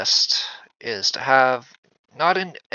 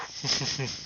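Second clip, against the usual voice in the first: cartoon laugh; 2 semitones higher, 10 dB quieter than usual. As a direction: giggle like a cartoon ghost. Creepy laugh after peekaboo.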